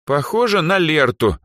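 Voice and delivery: put-on high, categorical girl's verdict; high, decisive pronouncement. A narrator's reading. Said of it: "категорично заявила Эльза".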